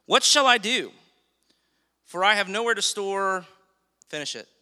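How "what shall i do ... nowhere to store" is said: loud, high, worried outburst of the rich farmer; loud anxious farmer asking what to do; rich fool's cry. Acted. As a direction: cry it out like a panicked landowner. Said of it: "how the man in the story refers".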